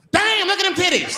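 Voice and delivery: put-on hollering at a girl; drunk guy catcall.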